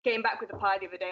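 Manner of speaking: ordinary talk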